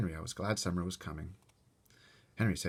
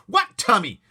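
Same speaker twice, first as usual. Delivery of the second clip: high, loud shouted repeat; 14 semitones higher, 16 dB louder, slower than usual. Acted. Brother shouting at Fudge, loud. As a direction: shout the question. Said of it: "I shouted at my brother".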